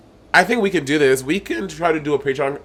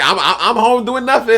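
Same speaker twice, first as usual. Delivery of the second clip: imitating Bianca; 8 semitones higher than usual. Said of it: "she was like".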